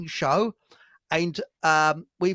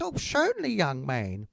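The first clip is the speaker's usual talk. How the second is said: woman shopper; 5 dB quieter than usual. Retold or imitated. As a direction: squeak it out like a fussy little old lady. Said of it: "And she said".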